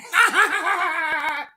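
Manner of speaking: high cackle of triumph; high gloating laugh. Real, not acted. Laughing at rival after the jab, high cackle. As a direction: cackle high, pleased with himself.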